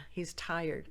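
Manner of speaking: ordinary delivery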